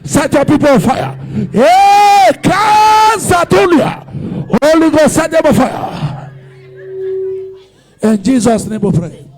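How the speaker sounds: loud, driving prayer shouts; fire prayer. Acted. Pastor calling fire on the congregation, loud preaching prayer. Fiery prayer opening the sermon.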